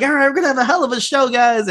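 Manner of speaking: high, fast announcer hype; hype-man promo. Retold or imitated. Wrestler hyping the locker room before the show, fast and high. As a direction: pump the crowd up like a ring announcer.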